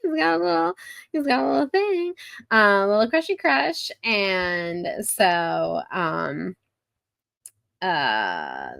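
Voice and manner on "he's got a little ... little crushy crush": high-pitched cutesy singsong; playful baby-talk lilt, pitched high; teasing coo. Pretend. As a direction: coo teasingly over someone's little crush. Cooing over a character's crush.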